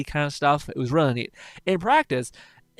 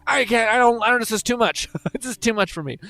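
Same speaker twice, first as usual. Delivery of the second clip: higher, flustered mock overwhelm; overwhelmed streamer; 7 semitones higher than usual. Streamer mocking PewDiePie's overwhelmed protest. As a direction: play him swamped and giving up. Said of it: "was basically just like".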